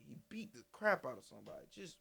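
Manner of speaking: unvoiced whisper aside. Real, not acted. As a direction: breathe it like a secret. Aside on the beating.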